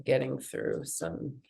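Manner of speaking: nasal, blocked-nose voice; talking through a stuffy nose. Real, not acted. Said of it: "if you couldn't tell with my nasalization voice".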